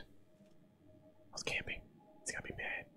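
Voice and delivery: hushed unvoiced aside; whisper. Real, not acted. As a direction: whisper it while hiding. Whispered aside while hiding.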